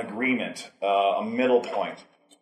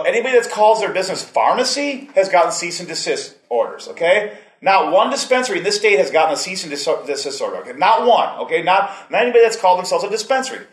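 higher and louder, pressing each point hard; retold heated argument; 6 semitones higher, 4 dB louder than usual. Arguing with the dispensary lady about legality, heated and loud. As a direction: hammer home the facts to her, getting heated. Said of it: "I got real mad at her".

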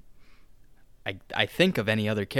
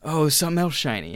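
higher, louder mock wonder at something shiny; mock amazement; 5 semitones higher, 3 dB louder than usual. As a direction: act easily dazzled by a shiny new thing. Plays Suicide Squad spotting magic.

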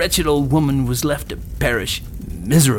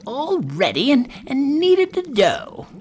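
very high, scheming crone voice; old dame; 13 semitones higher than usual. A narrator's reading. Witch readies the oven for Gretel, crone voice. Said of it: "said the old dame".

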